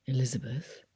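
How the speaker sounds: Darcy whispering to Elizabeth while dancing; whispered. A narrator's reading. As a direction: barely voice her name. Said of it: "he whispered".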